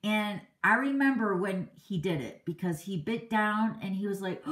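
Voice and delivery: normal talking voice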